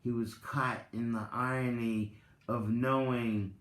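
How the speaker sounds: measured storyteller reading, lingering on key words; story narration. Acted. Reads Tao parable aloud.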